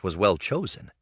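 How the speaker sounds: ordinary delivery, nothing marked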